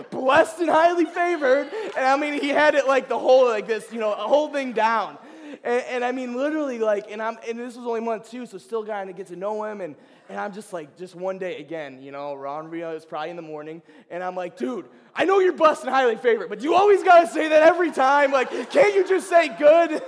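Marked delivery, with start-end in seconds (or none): 0.1-1.7 loud, high, slow stock answer; hammed-up cheerful catchphrase; imitating David. 14.6-20.0 loud, high, half-laughing complaint; louder retold rant to a friend; exasperated outburst.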